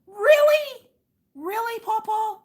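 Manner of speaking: high squeaky disbelief; mock astonishment, way up high; high teasing "Really, Pawpaw?"; incredulous. Real, not acted. Mocking disbelief at dad's praise.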